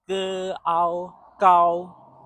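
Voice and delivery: ge ao gao drawn out slowly, sound by sound; slowed breakdown. Shown as a demonstration. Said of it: "So the short sentence".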